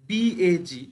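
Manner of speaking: letters drawn out for students; teacher spelling BAG slowly. Shown as a demonstration. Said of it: "আবার দেখো".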